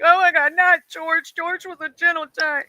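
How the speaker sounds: high, whiny fake sob over the gorilla; mock crying. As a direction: play up tearful grief for the film. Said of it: "you're over here crying like".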